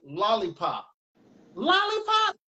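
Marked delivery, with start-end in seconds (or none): 1.5-2.4 much higher, held questioning squeal; puzzled pupils' echo. Acted. Students question the teacher's odd word, high puzzled echo. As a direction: imitate baffled kids echoing the teacher.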